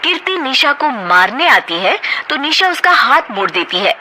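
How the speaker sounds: normal talking voice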